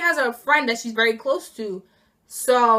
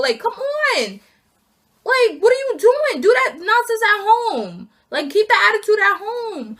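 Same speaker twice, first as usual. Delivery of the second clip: exasperated; 9 semitones higher, 4 dB louder than usual. Real, not acted. Exasperated rant at coworker.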